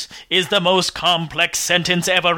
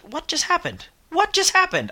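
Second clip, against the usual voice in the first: high, startled puzzlement; baffled at the lost notes; confused; 10 semitones higher than usual. Real, not acted. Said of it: "my notes went away".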